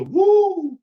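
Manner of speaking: high, loud imitated crowd woo; crowd cheer. Mimicking the stadium crowd, loud high woo. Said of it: "You hear the crowd say".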